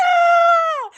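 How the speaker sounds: very high, loud retold shriek of a startled girl; imitated girl's scream. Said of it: "The girl was like".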